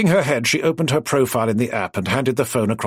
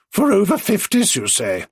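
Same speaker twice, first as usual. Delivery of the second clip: surprised; 8 semitones higher than usual. Acted. Minty intrigued by dating app.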